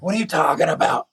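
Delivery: high-pitched confrontational challenge. Retold or imitated. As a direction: imitate your own blunt comeback. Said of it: "that's, like, offensive and loud".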